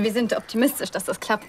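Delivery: ordinary talk, nothing marked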